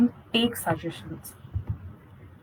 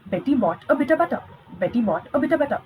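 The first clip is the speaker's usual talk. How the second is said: Betty bought a bit of butter said fast and quicker each time; fast tongue twister; 3 semitones higher, much faster than usual. Shown as a demonstration. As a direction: rattle it off fast, speeding up. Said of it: "let's do one tongue twister here".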